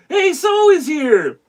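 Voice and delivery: high, drawn-out happy call; excited greeting. Real, not acted. Host spots a viewer arriving, excited greeting. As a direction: greet them high and stretched out.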